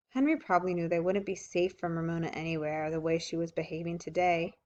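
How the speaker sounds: usual speaking voice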